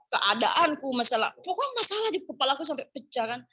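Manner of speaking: ordinary talk, nothing marked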